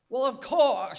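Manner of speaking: loud, matter-of-fact New England voice; reply with regal New England certainty; New England accent. Retold or imitated. Grandmother answering granddaughter, loud New England accent. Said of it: "New England Katharine Hepburn accent".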